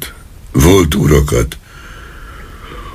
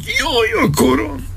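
very high, strained wail of pain; shrieking pain howl; howled cry of pain; 6 dB quieter than usual. A narrator's reading. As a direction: cry out in agony, pitched way up. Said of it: "Ordította el magát".

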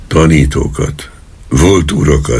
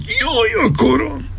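a sudden shrill, much higher pain scream; 6 dB quieter than usual. A narrator's reading. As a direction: cry out as the pain hits. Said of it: "Ordította el magát". (fájdalmas, éles sikoly)

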